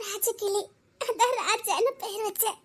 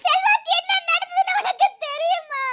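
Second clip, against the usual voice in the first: much higher, broken by sobs; sobbing cry; 8 semitones higher, 3 dB louder than usual. Acted. Grieving wife to her friend, sobbing. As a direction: cry the question out. Woman sobbing about Selva.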